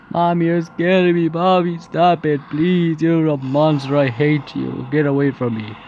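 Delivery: high, loud pleading cry; terrified child wailing. Acted. Daughter begs monster mother to stop, terrified wail. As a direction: wail in panic, pitch easing down toward the end.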